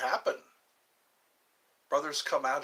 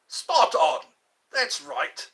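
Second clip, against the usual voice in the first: loud, much higher British exclamation; British accent; 9 semitones higher, 8 dB louder, slower than usual. Retold or imitated. British accent cheering chat on the impression. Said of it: "that accent".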